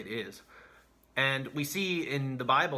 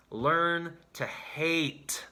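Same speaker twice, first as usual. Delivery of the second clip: very slow emphasis; 2 semitones higher, much slower than usual. Acted. Preacher's closing challenge.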